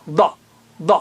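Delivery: ض said thick and heavy; teacher repeating the dad sound. Shown as a demonstration. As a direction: give ض a full heavy sound. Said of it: "اسی طرح ضاد بھی".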